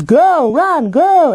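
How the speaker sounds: high cheering yell; mock yelling. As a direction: shout like a fan in the stands. Imitating fans yelling.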